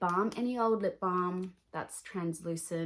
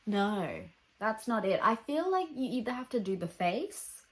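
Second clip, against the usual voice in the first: normal talking voice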